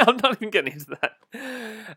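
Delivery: chuckling, half-amused repeat; laughing. Real, not acted.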